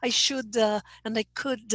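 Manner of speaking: "should" and "could" each released with "uh"; intrusive schwa. Shown as a demonstration. Teacher listing student examples, intrusive schwa. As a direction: add a little "duh" to "should" and "could". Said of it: "and not use the schwa".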